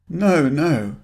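he wants to flee the truth; panic. Acted. Graham hears his past named, panicked denial. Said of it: "panic swelling within him".

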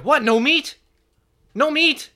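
loud, high mock indignation; incredulous accented yell; imitated mad outburst. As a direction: imitate an outraged restaurant lady. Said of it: "and then she gets mad".

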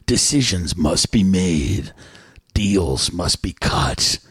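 deep, slow epic trailer narrator; movie trailer voice. Pretend. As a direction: announce it like a blockbuster trailer. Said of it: "the movie trailer voice".

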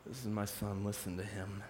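a touch of the divine voice; voice of God. Acted. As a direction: intone it quietly from above. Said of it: "And then God says".

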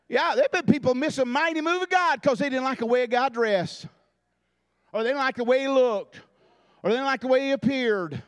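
rising pulpit preaching cadence; fervent sermon delivery. Acted. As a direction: preach it out to the congregation. Preaching at full force.

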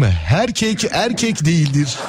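slow announcer slogan. Acted. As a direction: read it as a slow ad tagline. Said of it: "reklam sesiyle".

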